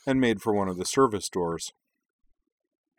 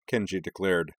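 usual speaking voice

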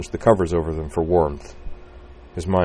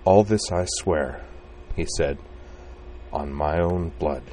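solemn slow oath; 3 dB quieter than usual. Acted.

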